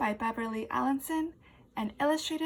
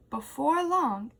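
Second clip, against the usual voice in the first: ordinary delivery, nothing marked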